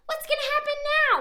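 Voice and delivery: ordinary delivery, nothing marked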